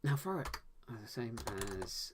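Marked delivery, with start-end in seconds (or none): 0.0-0.5 high, loud urgent order; yelled command. Acted. Writer voicing the friend's order, yelled. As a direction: yell it as a quick command. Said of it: "just as forces yelled".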